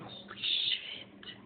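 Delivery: breathless, very soft and slow whisper; slow awed whisper; whispered awe. Retold or imitated. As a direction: breathe it out in stunned wonder. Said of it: "you're just like".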